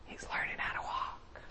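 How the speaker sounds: hushed whisper of delight; a soft whisper shared with Gabriel. Pretend. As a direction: whisper it like a proud parent watching. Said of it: "he's like".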